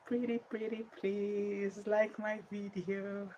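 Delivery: high-pitched pleading beg. Real, not acted. Streamer begging viewers to like the video, pleading.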